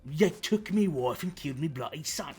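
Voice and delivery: loud, rough-and-ready British accent impression; Billy Butcher. Billy Butcher yelling at Homelander, loud mock British accent. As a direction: bellow it in a put-on British accent, pitch jumping up. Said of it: "he's known for having that accent".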